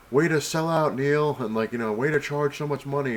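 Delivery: high, loud jeering call of a passerby; mock heckle. Passerby jeering at the artist, a loud mock heckle. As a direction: toss out a loud sarcastic jab.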